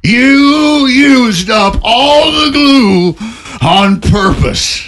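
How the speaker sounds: very high, slow furious shout; shaking with anger. Acted. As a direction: shout it, quivering with fury. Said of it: "stood quivering with fury, stammering".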